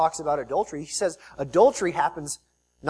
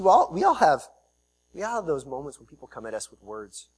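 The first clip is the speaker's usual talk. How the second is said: ordinary delivery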